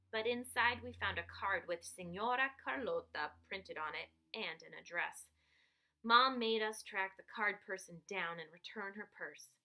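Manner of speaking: ordinary delivery, nothing marked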